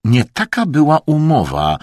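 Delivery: refined but brusque protest; curt objection. A narrator's reading. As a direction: cut in as roughly as a refined man can. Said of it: "tak szorstko, na ile tylko mu pozwalał".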